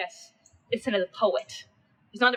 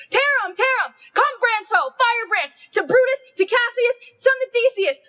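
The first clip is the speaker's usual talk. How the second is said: much higher and louder, yelling; frenzied shout; 13 semitones higher, 6 dB louder than usual. Acted. Mob yelling to tear Cinna apart, frenzied shout. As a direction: scream for blood like a lynch mob. Mob rallies to burn houses.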